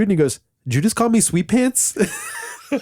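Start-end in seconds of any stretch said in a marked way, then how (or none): 0.7-2.0 indignant retort. Retold or imitated. Rock singer offended at a fan's nickname, challenging. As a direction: throw the nickname back at him.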